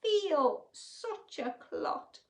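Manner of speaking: high, loud self-pitying giraffe voice; Gerald's sad lament. Acted. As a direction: wail it like a clumsy giraffe.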